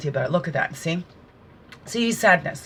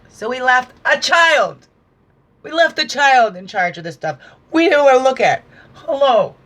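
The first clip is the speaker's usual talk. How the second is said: emphatic exhortation; 5 semitones higher, 6 dB louder than usual. Real, not acted.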